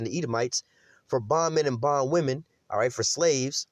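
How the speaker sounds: usual speaking voice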